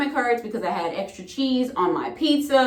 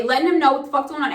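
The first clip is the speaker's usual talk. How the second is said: ordinary talk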